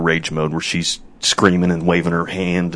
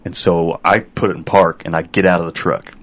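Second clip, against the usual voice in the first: ordinary talk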